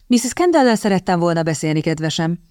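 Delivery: ordinary delivery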